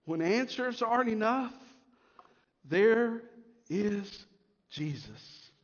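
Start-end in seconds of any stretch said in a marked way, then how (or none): 2.6-5.5 very soft, low, slow; hushed close. Real, not acted. Pastor closing tenderly, quiet and slow. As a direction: let it fall almost to a whisper. Whispered hush.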